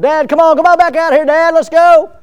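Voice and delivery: put-on high, loud eager kids calling dad. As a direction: call out like excited kids begging dad to play.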